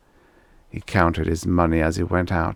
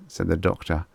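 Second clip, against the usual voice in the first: ordinary delivery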